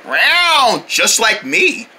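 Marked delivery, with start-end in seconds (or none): 0.1-0.8 short cat yowl; cat meow. Acted.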